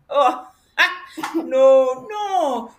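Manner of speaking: chuckling no, no; laughing it off. Acted. Laughs at his own joke.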